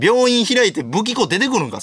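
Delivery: high, slow outraged disbelief; high drawn-out retort; baffled retort. Real, not acted. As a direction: stretch it out in disbelief.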